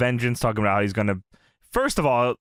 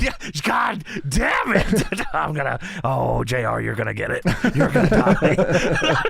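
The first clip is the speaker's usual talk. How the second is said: mock furious Vince. Acting out Vince's backstage fury.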